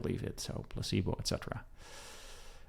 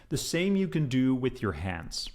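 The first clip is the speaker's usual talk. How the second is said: ordinary talk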